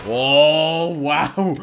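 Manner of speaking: amazed. Real, not acted. Shocked at a replay.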